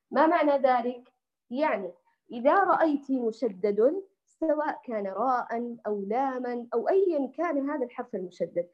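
usual speaking voice

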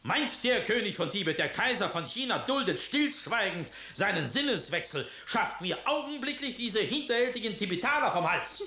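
indignant ranting. Acted. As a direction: storm at the treacherous Tibetans. Emperor raging at Tibet.

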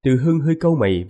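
usual speaking voice

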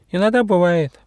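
high butterfly voice, conceding; sheepish high-pitched admission; admitting. Acted. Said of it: "призналась бабочка".

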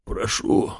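rasping plea, slow; wheezing. A narrator's reading. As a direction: croak it out like a dying man. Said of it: "прохрипел он".

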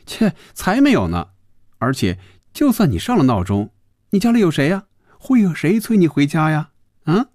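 high-pitched scornful scoff; disdainful. A narrator's reading. Phoebe mocks his alarm clock claim, disdainful. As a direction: scoff at them in a high woman's voice. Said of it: "有些鄙夷地说".

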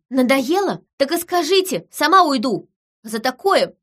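high, heated challenge; angry outburst. A narrator's reading. Wife threatening to leave by herself, angry and high. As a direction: fling the threat at them.

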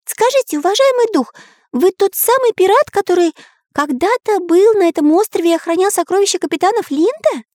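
very high, polite cartoon hero voice; eager artist character. Artist asks spirit about the treasure, high eager voice. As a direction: question a spirit respectfully, pitched high.

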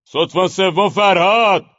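slow, high, loud summons; general's bellow. A narrator's reading. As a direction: bellow for the officer from the tent. (فریاد ژنرال)

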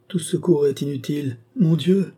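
high, despairing exclamation. A narrator's reading. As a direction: lament rising to an outcry. Despairing woman in narrated dialogue.

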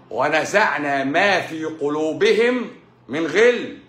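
slow, measured, solemn recitation; Quranic recitation. Acted. Quoting the verse to his viewers, slow solemn recitation. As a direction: intone each word slowly.

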